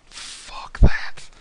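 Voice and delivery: whispered aside to the table. Real, not acted. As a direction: breathe it out, no voice. Whispered, then said aloud.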